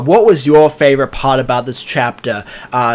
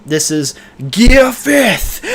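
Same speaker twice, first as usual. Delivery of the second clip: slow, higher hero shout announcing a power-up; Luffy's battle shout; 6 semitones higher, slower than usual. Retold or imitated. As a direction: call out the transformation slowly like a shonen hero. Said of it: "and he's like".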